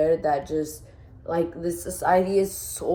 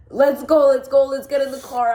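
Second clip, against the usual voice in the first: higher, louder, urgent retold yell; retold crying scream; 6 semitones higher, 6 dB louder than usual. Retelling rushing him to the car, urgent mock yell. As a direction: re-enact a panicked order, raised but short of screaming. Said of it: "started crying and screaming".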